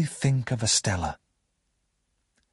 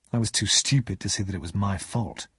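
ordinary delivery

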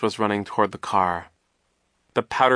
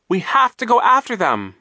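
high, loud, urgent plea; frantic high-pitched shout; desperate scream; 13 semitones higher, 8 dB louder than usual. A narrator's reading. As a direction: scream for pursuit. Violet repeats plea to adults.

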